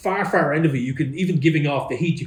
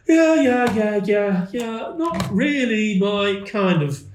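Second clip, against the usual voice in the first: high, drawn-out halfling muttering over a patient; 8 semitones higher, 3 dB louder, much slower than usual. Acted. Halfling looking the captain over, high halfling voice. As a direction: play the halfling sizing someone up in a high voice.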